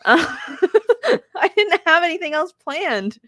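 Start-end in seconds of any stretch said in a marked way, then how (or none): 0.2-1.3 sudden high burst of laughter; high embarrassed laugh. Real, not acted. Put on the spot by her husband, high embarrassed laugh. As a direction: laugh it off, caught out.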